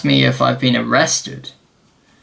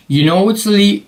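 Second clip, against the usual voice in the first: put-on higher, louder start on an American accent; attempted American accent; 8 semitones higher, 3 dB louder than usual. Officer warning the traveller about work, attempted American accent. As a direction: try an American accent, then break off. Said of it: "not gonna do the American accent".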